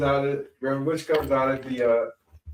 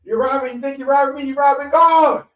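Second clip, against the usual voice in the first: much higher, louder, slowing on God; guilt-trip them hard from the pulpit; preaching shout; 13 semitones higher, 7 dB louder than usual. Retold or imitated. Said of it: "He was just like".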